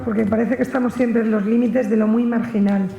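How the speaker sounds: ordinary talk, nothing marked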